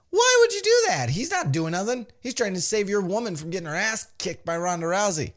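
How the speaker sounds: hammed-up scolding of the wrestler; mock outrage. Indignant outburst at Triple H.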